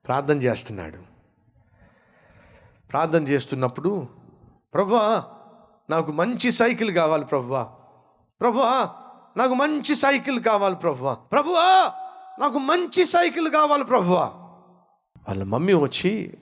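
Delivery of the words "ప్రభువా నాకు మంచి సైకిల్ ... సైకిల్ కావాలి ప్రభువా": put-on child's plea building from normal to a high shout; a boy begging God louder each time until he yells; mock child prayer rising to a shouted ప్రభువా; prayer growing loud. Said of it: "అంత గట్టి గట్టిగా ప్రార్థన చేస్తున్నావు".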